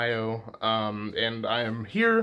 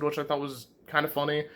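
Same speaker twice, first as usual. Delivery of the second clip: ordinary talk, nothing marked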